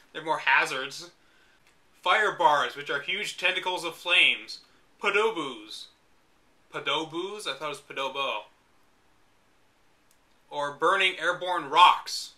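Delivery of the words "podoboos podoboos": ordinary talk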